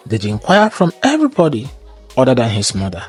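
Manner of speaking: plain reading voice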